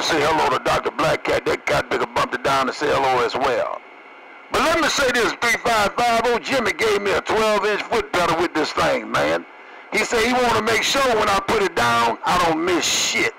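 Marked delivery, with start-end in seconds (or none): none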